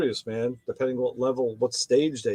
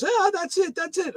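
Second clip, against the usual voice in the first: put-on much higher excited Jamaican accent. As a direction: mailman confirming eagerly, Jamaican lilt. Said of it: "So he got this Jamaican accent".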